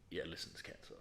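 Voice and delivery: low, hushed retold verdict; grave doctor. Doctor telling patient it's cancer, gravely hushed. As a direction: drop right down to break bad news. Doctor gently breaks bad news.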